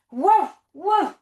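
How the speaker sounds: loud bouncy woof; playful dog bark. Pretend. Teacher barking with the kids as a dog pack, loud and playful. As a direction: bark like a happy puppy.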